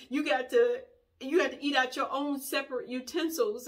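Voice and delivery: high, halting mock sobs; pretend crying. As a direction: wail it out, stammering through fake tears. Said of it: "pretending like I'm crying".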